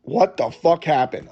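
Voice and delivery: ordinary talk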